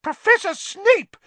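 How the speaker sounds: very high, loud outraged shriek; Madame Pumphrey shrieking. A narrator's reading. Matron scolding a raging professor, shrieking. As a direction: shriek a scandalised reprimand. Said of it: "shrieked Madame Pumphrey".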